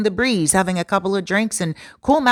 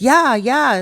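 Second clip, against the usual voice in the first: eager yes; 5 semitones higher, 5 dB louder than usual. Retold or imitated. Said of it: "And he's like".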